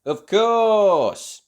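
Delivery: loud, high, confident boast; shouted. A narrator's reading. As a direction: shout it out proudly. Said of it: "shouted Tim".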